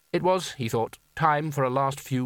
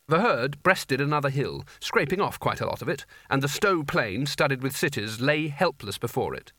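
usual speaking voice

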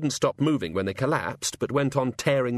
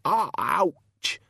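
a sudden higher "ah, ouch" of pain; pained yelp; 8 semitones higher than usual. Acted. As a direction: yelp as the headache stabs. God winces mid-speech.

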